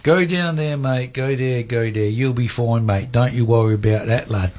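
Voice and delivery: chatty regional English accent, imitated; broad British accent, reassuring a lost traveller. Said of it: "he said in this broad English accent".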